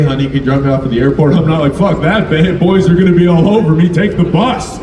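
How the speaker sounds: normal talking voice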